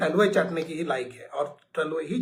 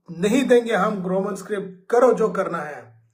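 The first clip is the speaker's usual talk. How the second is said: louder mock defiance of a minister; arrogant challenge; 3 semitones higher, 7 dB louder than usual. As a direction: throw it out as a haughty dare. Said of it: "ये घमंड की बात हो गया".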